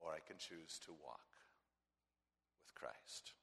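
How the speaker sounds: soft, fading whisper; hushed. Acted. Preacher closing the choice in a soft whisper. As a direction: breathe out the choice.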